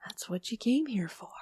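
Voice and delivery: unvoiced, hushed aside; whisper. Teasing the listeners in a whisper. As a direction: breathe it like a secret.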